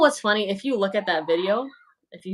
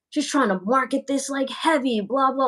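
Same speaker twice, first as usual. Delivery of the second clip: higher-pitched mimicry of a naysayer; mock accusing critic; 6 semitones higher than usual. Streamer mimics a doubter of sponsored fragrance reviews, mock accusing. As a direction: imitate a dismissive critic.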